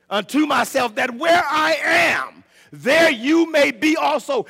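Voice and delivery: loud, high, slow proclamation; full-force scripture preaching. Acted. Preacher quoting Jesus's promise to the church, full-force preaching.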